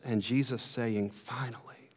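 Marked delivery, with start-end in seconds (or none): 1.1-1.9 hushed, breath-only whisper. Acted. Jesus answering one who feels undeserving, whispered. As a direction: whisper it as a quoted aside. Jesus' quoted relieved reply.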